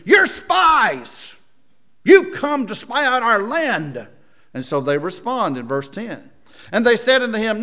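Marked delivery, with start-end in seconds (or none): 0.0-4.1 high, loud, accusing yell; shouted accusation. Acted. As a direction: Joseph as governor accusing the brothers, shouting. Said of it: "He accused them".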